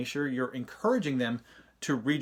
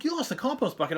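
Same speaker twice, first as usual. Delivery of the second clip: much higher disbelieving echo of his words; incredulous dad; 11 semitones higher than usual. Retold or imitated. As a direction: throw his words back in shock. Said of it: "like, dad rant".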